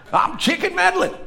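high-pitched, hammed-up Southern shipmate; South Carolina accent. Shipmate repeating his odd name, South Carolina accent. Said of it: "He's from South Carolina".

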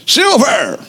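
high-pitched prospector's cry; Yukon Cornelius. Retold or imitated. Quoting the movie prospector, hammed-up cry. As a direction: call it out like an excited prospector. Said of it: "as you know, Yukon Cornelius".

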